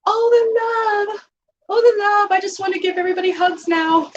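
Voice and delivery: high, gushing with affection. Retold or imitated. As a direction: gush like your heart is melting. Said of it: "I was like, my heart was melting".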